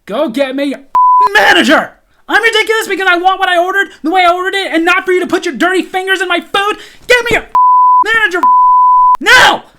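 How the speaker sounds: loud, high shouting demands; furious yelling. Retold or imitated. Customer demanding a manager from the waitress, yelling furiously. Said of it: "And I went nuts".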